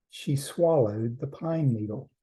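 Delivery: ordinary delivery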